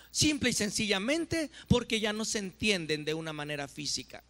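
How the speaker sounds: ordinary talk, nothing marked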